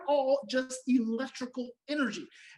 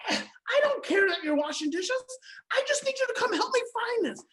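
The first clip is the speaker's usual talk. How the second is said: dismissive demand, pitched higher; pushy requester; 11 semitones higher than usual. Retold or imitated. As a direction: wave off their excuse, pitched up. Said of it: "the person who's asked you to come help them".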